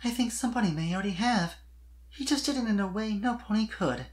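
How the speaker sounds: hint at it playfully; shy hinting young mare. A narrator's reading. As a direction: Sweetie Belle coyly hinting at Spike. Sweetie hints her feelings.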